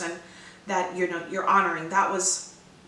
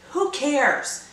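emphatic; 6 semitones higher, 5 dB louder than usual. Real, not acted. Answering the doubt.

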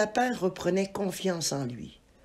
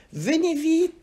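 «Venez vite» crié nettement plus haut et plus fort; cri pressé; 10 semitones higher, 4 dB louder than usual. Acted. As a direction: appeler à l'aide, pressé. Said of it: "s'écria un inspecteur". (l'inspecteur crie au commissaire, très aigu et fort)

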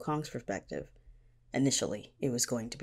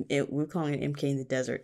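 normal talking voice

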